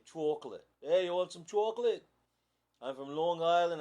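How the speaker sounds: put-on light Long Island accent, "Chocolate" as "tawk-lit"; hammed-up Long Island local offering chocolate. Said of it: "I could say it like they say, like, in Long Island".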